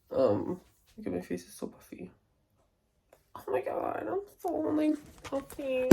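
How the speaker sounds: usual speaking voice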